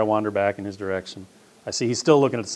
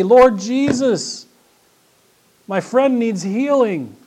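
slow, higher, louder, pleading; earnest prayer; 12 semitones higher, 8 dB louder, much slower than usual. Acted. Believer praying to Jesus for a friend, earnest slow plea. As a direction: lean into a heartfelt plea.

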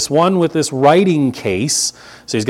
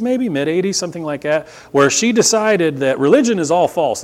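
ordinary talk, nothing marked

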